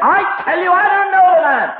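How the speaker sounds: high, loud outburst; hammed-up furious protest; desperate denial. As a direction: shout it down, building to a frantic yell. Acted Peter's heated denial.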